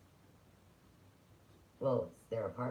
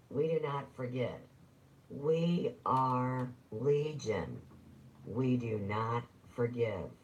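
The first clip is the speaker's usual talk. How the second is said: slow, emphatic proclamation, pushed louder; mock declaimed slogan; 2 semitones higher, 5 dB louder, slower than usual. Declaiming a legion's motto to the listeners, slow and emphatic. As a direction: intone each word like a grand threat.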